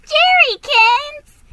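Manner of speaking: high, loud, drawn-out shriek. A narrator's reading. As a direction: shriek the nickname in delight, slowly. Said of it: "she shrieked".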